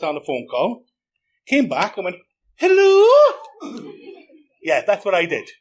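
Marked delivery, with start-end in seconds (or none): 2.6-3.1 high squeaky put-on girl's voice; mock daughter voice. Scammer faking his daughter on the phone, squeaky high voice. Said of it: "pretended to be his own daughter".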